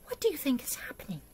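quick, breathy whispered question. Acted. Teacher asking the listening children what is happening, whispered. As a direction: whisper it fast to the kids, full of suspense.

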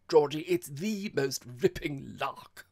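loud, high, slow relish; gleeful exclamation. A narrator's reading. Girl tells her vexed host about the lark, gleefully. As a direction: stretch it out with delight.

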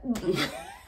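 a breathy, voiceless giggle held back; stifled laugh. Real, not acted. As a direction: laugh quietly at yourself before the joke. About to try an accent.

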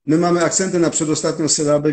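ordinary talk

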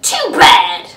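loud, rude retort; grouchy retort. Acted. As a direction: throw it back rudely. Grouchy ladybug retorts again.

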